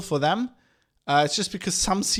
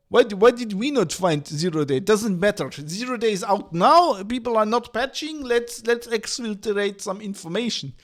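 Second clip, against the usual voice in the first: mock Russian hacker accent, higher and loud; 4 semitones higher, 4 dB louder than usual. Russian hackers gloating over the leaked zero day, in a mock Russian accent. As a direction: ham up a Russian accent, gleeful and pushy. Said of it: "Like, the Russians go".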